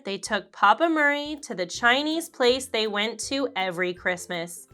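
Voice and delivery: ordinary delivery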